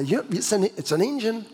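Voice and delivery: laughing through a deadpan line; joking self-mockery, laughing; mock know-it-all. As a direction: pretend expert, cracking up. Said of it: "lift up the bonnet and say".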